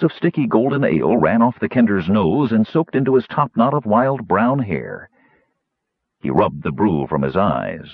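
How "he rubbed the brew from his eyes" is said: usual speaking voice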